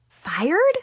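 high, rising, alarmed question; gulped question. A narrator's reading. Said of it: "I gulped".

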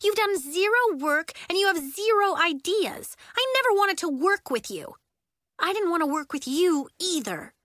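put-on high, heated child's accusation; indignant, high-pitched argument; quarrelling. A narrator's reading. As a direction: lash out at your project partner. Two girls in acted quarrel.

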